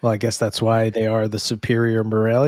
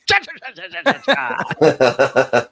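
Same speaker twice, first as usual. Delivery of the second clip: chattering noise; 5 semitones higher, faster than usual. Retold or imitated. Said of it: "He's like".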